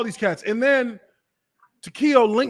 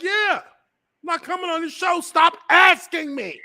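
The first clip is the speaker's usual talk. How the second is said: high-pitched mock outrage, building louder; exasperated refusal; 11 semitones higher, 5 dB louder than usual. Host imitates the former player refusing his show, exasperated. Acts out guest's blunt refusal.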